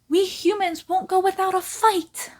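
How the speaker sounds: high, loud nasal character voice; nasally voice. Acted. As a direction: pinch it through the nose, pushed up high. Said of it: "It's gonna be a nasally voice".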